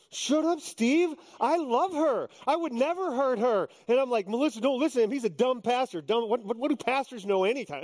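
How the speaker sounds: put-on high, indignant young groom; mock-outraged fiancé rejecting the counselor; inner protest. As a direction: protest it in your head, high and huffy. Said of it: "Inside, I'm, like, going".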